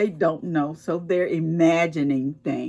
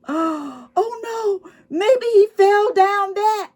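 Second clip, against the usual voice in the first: high, loud wail; a cat wailing at the cliff edge; 4 dB louder, slower than usual. A narrator's reading. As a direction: cry it out in alarm as a frightened cat. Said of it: "wailed the cat".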